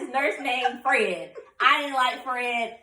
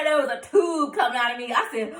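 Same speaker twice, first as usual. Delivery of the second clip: usual speaking voice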